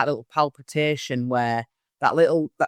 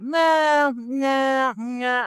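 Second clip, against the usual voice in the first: high, brushing-off string of nos; dismissive no; 10 semitones higher, much slower than usual. Retold or imitated. Medium imitating a bored sitter rejecting everything, high dismissive nos. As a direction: wave it all away, imitating the sceptical client.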